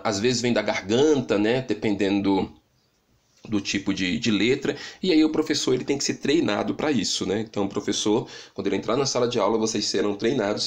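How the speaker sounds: ordinary talk, nothing marked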